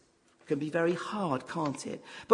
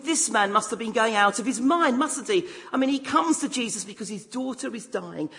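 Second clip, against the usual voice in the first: ordinary delivery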